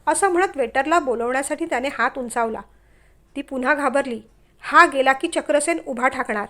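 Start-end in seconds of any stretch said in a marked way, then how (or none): none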